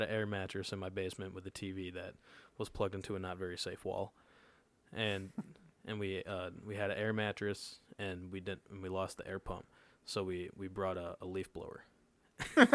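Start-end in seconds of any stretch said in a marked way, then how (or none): none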